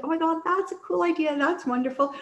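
thrilled by her idea; excited praise. Retold or imitated. Admiring a friend's idea.

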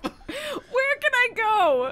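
panicked. Real, not acted. Lost in game, panicking laughingly.

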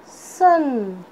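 ordinary talk, nothing marked

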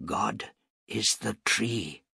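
soft and slow; softly. A narrator's reading. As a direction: speak it gently and slowly. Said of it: "Nu responded softly".

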